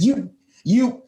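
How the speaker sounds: sputtered retold outburst, halting before the insult; stammering mock-angry insult; mock anger. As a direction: act out a mildly angry struggle for words. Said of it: "filled with anger".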